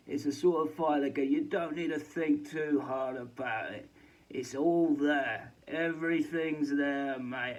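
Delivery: mock pundit. Darren Barker explaining the fight, hammed-up and slow. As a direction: ham up a laddish boxing pundit.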